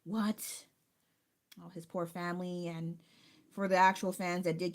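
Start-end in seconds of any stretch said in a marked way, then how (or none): 0.0-0.7 slow, drawn-out gasp of disbelief; retold shock that he was so young; stunned disbelief. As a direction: let it sink in slowly, aghast. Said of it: "I was like".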